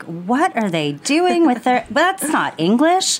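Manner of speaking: mock bewilderment, high and drawn-out; hammed-up incredulous outburst; baffled millennial. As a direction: exclaim in exaggerated, slow disbelief. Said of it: "you're a millennial and you hear Gen Zers speak".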